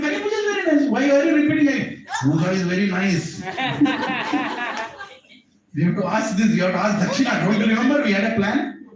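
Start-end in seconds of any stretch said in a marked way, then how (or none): 0.0-0.9 high, shouted praise; giddy shout. Retold or imitated. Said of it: "He said". 0.9-3.2 said through laughter; laughing. Retold or imitated. Teasing the repeating father. 3.3-5.0 a real high laugh. Real, not acted. Storyteller laughs at repetition.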